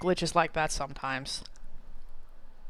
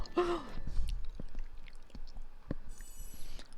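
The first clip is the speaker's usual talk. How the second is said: dramatic gasp; 11 semitones higher, 10 dB quieter than usual. Pretend. Mock fear at the boss portal.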